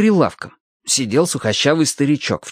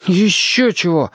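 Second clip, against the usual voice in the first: mildly indignant exclamation, pitched up; exclaiming; 7 semitones higher, slower than usual. A narrator's reading. Boy denies that the granddaughter likes him, exclaiming. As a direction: protest it, a little higher. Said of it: "воскликнул Алёшка".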